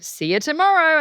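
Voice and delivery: high, cheery sign-off; breezy. Retold or imitated. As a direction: toss it off brightly. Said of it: "I was like".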